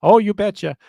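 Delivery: put-on Minnesota accent. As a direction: ham up a Minnesota nice accent. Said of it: "Is that the accent you have?".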